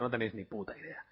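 ordinary talk

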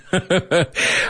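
light laugh. Real, not acted. Laughing at lisp joke.

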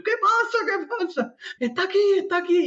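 high, frantic cries of alarm; alarmed flatmate. Retold or imitated. Edu behind the glass door, frantic high cries. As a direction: panic as if the bug is right there. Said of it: "El Edu".

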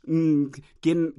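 usual speaking voice

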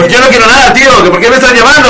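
loud, high, irate Castilian customer; fast mock-Castilian outburst; Spain Spanish. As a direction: play an angry Spaniard refusing a sales call. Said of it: "¿Cómo era tu gallego?".

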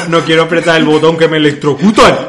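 loud, high whiny customer impression; mock a scaredy-cat client; mock whine. (imitación quejica y aguda)

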